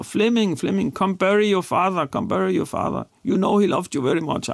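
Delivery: high-pitched imitated plea in English; aunt calling out. Aunt urges her nephew to bury his father, high-pitched imitated call. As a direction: echo the aunt's high, pleading cry at the graveside. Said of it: "hun råber".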